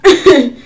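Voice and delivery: a loud burst of laughter. Real, not acted. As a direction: laugh out at the story. Laughing at the story.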